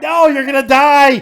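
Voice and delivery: loud panicked yell; alarmed shout. Real, not acted. Host panics, caller driving.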